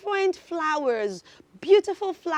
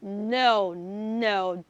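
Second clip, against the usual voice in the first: normal talking voice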